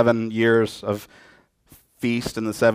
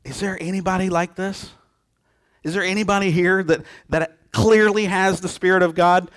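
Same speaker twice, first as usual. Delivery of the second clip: higher, wondering king; amazed Pharaoh; 7 semitones higher than usual. Retold or imitated. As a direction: marvel aloud at Joseph, pitched up. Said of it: "what does Pharaoh say?".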